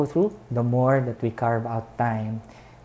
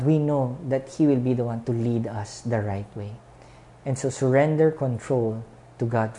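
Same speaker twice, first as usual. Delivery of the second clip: ordinary talk, nothing marked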